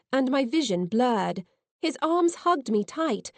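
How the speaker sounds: ordinary delivery, nothing marked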